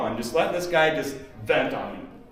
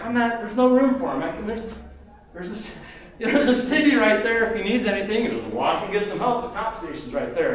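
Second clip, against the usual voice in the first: higher, louder, fast rushed justification; excited protest; 8 semitones higher, 4 dB louder than usual. Retold or imitated. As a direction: talk fast to calm her down. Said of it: "And I'm like".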